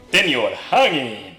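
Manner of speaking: usual speaking voice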